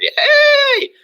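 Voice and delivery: very high, loud, drawn-out cheery hey; over-bright mock greeting; bubbly greeting. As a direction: squeal a big happy hello. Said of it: "so used to me being like".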